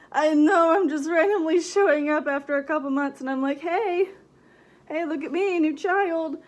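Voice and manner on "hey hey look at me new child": usual speaking voice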